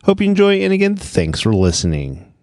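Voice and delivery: ordinary delivery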